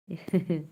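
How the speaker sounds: short chuckle; small real laugh. Real, not acted. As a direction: giggle briefly. Laughs after saying bye.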